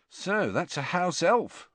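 ordinary delivery

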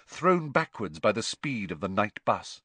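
ordinary delivery, nothing marked

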